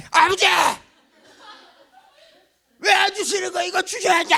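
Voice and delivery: high, full-force yell at Father; mock-praying in a loud, high shout; shouted prayer demand. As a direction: scream it out like a throat-tearing prayer. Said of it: "그냥 소리만 지르는 거야".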